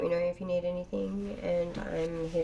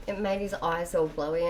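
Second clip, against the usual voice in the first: normal talking voice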